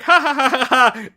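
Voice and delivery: loud, high mock sitcom laugh; canned sitcom laughter. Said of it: "everyone's like".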